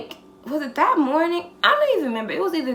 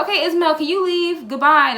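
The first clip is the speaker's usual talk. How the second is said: raised, high-pitched send-off; loud dismissal; 7 semitones higher, 8 dB louder than usual. Retold or imitated. Woman openly sends friend out of the argument, loud dismissal. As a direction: act out loudly shooing someone away. Re-enacts loudly sending Ismael off.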